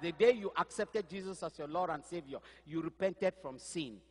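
usual speaking voice

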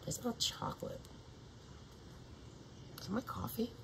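barely audible whisper; whispered aside. Real, not acted. Wondering to herself about a chocolate smell, whispered aside. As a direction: whisper it to yourself, puzzled.

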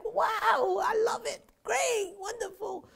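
high, gushing delight at something wonderful; elated gush. Retold or imitated. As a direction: swoon over it, thrilled.

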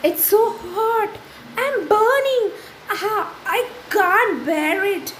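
a tree in agony from the flames; burning tree. Acted.

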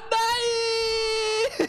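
high despairing wail of no; anguished cry. Real, not acted. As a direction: wail it like a lost run. Game death.

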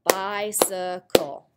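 "Bicycle" slowly in three separate beats; syllable by syllable. Shown as a demonstration. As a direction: sound out each syllable of "Bicycle". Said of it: "Which syllable has the long I".

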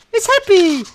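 high, gleeful squeal; bright-eyed delight, high-pitched; playfully happy. Pretend. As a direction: jump up high for the happy face. Watching face flip sad, happy.

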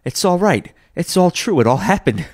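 high, eager exclaiming; giddy excitement. Acted. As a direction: point out each thing in delight. Scrooge excitedly recognising the room.

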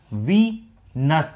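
"Venus" very slowly, as Ve, pause, nus; split into syllables. Shown as a demonstration. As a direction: separate the two syllables of "Venus". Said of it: "nus is the second syllable". ("Venus" को दो हिस्सों में धीरे-धीरे बोलें)

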